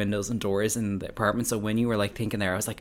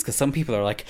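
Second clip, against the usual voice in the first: normal talking voice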